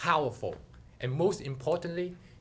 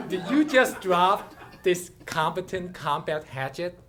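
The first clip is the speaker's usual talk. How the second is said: laughing; 4 semitones higher, 5 dB louder than usual. Acted. Goddess speaks, speaker laughing.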